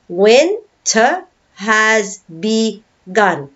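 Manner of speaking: slow, separated teaching beats; syllable by syllable. Shown as a demonstration. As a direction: break the line into spaced-out syllables. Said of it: "A line of five syllables again".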